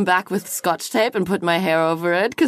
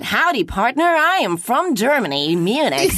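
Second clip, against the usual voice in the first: American cowboy accent; 2 semitones higher than usual. Shown as a demonstration. Guest answering the host's accent test, American cowboy accent. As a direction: play a cowboy introducing himself, American accent. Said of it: "do an American cowboy".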